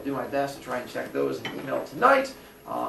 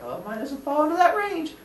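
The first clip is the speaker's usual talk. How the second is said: high-pitched mock student realization; imagined student; 14 semitones higher than usual. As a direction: quote a student in a much higher voice.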